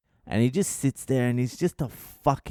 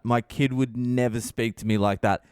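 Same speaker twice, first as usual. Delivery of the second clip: ordinary delivery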